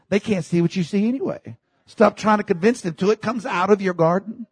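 ordinary talk

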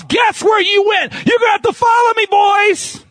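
high, loud shouting preacher; preaching at full force. Acted. Preacher to congregation, shouting about baptism at full force. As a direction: shout it from the pulpit, climbing.

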